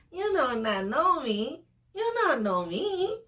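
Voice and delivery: high, laughing mock retort; playful protest. Laughing retort to clingy date.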